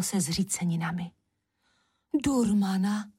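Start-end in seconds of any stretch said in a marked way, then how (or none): 2.2-3.1 mildly exclaimed name in alarm; alarmed cry. A narrator's reading. Sisters recognise the witch, alarmed cry. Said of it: "vykřikly sestry hašteřilky".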